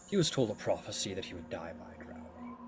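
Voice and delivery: soft, subdued god voice; grudging sea god. Acted. Poseidon explains himself to Hera about his daughter, low-key and grudging. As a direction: justify yourself grudgingly, keeping it quiet.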